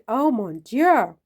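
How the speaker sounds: a slow, loud hammed-up French exclamation; mock French. Mock French exclamation over wine.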